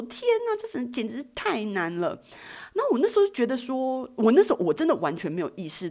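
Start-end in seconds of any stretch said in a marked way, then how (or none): 0.0-2.2 imitating a startled wail; retold friends' cry. Friends balking at the climb, mild mock yell. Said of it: "两个朋友就在旁边大叫说".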